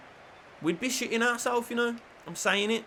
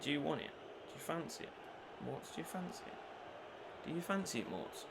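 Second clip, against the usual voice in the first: whispered coaxing; 3 semitones lower, 18 dB quieter than usual. Real, not acted. Gamer whispers to his player about shooting, hushed coax. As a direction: tempt him softly.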